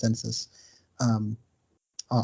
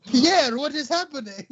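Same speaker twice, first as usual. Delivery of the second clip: high, panicky comic French voice; cartoon Frenchman in alarm; French accent; 13 semitones higher than usual. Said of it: "you know they're French".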